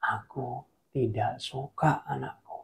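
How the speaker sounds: slow, low, hushed God; quiet, deliberate fatherly rebuke; soft voice of God, drawn out; God's voice. Acted. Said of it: "Lalu Tuhan jawab sekali lagi".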